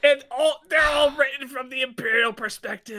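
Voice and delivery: high-pitched indignant cry; exasperated shout. Real, not acted. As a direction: blurt it out in outrage. Co-host joins the outraged rant.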